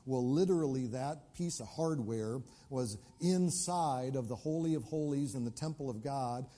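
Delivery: normal talking voice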